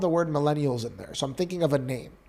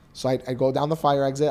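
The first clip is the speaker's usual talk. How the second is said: ordinary delivery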